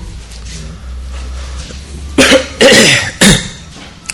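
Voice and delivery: coughing fit. Real, not acted. Speaker apologizes for sore throat.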